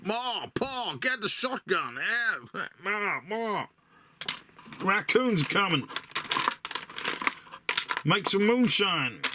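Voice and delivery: high, hammed-up hillbilly American accent calling to Ma and Pa; bad American accent. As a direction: overdo a rural American drawl, yelling from the porch. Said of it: "my shite American accent".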